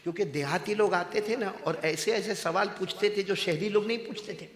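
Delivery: ordinary delivery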